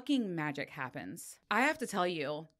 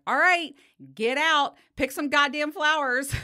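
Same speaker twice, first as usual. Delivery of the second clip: loud, higher-pitched parent's command; retold barked order; 8 semitones higher, 9 dB louder than usual. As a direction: order the kid out, loudly, half laughing at the end. Hurried parent orders child out.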